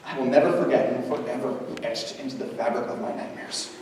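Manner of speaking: ordinary talk, nothing marked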